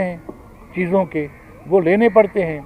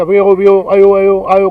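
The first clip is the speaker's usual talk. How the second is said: louder, sing-song mock of Sindhi calls; mocking taunt; 7 dB louder than usual. TV drama mocking Sindhi speakers, loud sneering imitation. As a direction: taunt them by aping how they call out. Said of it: "करके तंज करेंगे".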